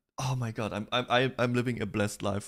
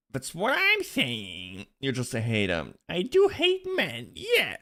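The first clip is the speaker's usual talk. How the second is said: mocking voice; 3 semitones higher, slower than usual. Reading tweets in mock voice.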